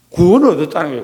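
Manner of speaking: 구원을 stressed with a firm fall, said out full; preacher announces salvation to the congregation, firm and full; firm declaration. Shown as a demonstration. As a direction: proclaim 구원을 얻었다는 거예요 strongly. Proclaiming then echoing softly.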